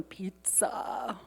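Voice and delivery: a quick breathy whisper, voice dropped away; whispered aside. Real, not acted. To the congregation, teasing about lunch, whispered. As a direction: slip it in as a playful secret.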